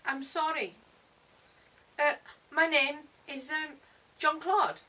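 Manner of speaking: put-on hesitant, high-pitched French boy; French accent. A narrator's reading. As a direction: introduce yourself haltingly in a French accent. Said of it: "sounds French, like my French teacher".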